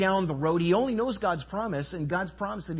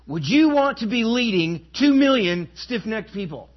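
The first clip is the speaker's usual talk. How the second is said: louder and higher, throwing the question at the crowd; challenging outcry; 5 semitones higher, 7 dB louder than usual. Real, not acted. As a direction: demand an answer from the congregation. Preacher challenges congregation.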